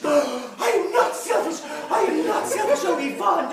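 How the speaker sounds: loud protest climbing very high; outraged shriek. Acted. Mother denying her son's charge of selfishness, outraged.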